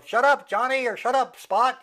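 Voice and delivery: high, loud call to a barking dog; mock yelling. Dog owner yelling out the window at a barking dog, mock shout. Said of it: "they yell out their window".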